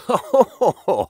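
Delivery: high bursting laugh; hearty laugh. A narrator's reading. Pirate laughing at Watson's sea raid joke, hearty laugh. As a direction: let a big laugh roll out. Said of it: "again broke out in laughter".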